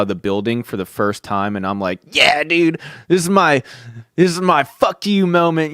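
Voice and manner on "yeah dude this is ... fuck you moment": higher, cocky and gleeful; triumphant. Retold or imitated. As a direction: crow it like a victory. Triumphant leaving school.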